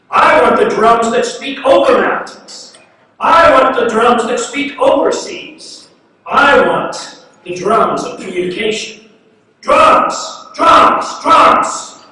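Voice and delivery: loud, insistent poetic demand; impassioned cry. Acted. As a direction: cry out the demand, hammering each repeated word. Poem's demand builds to cries.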